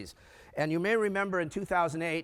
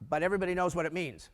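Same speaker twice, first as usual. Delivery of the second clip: normal talking voice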